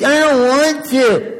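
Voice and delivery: high, slow whining refusal; whiny kid. Retold or imitated. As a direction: drag it out like a sulky child. Acted child refusing chores.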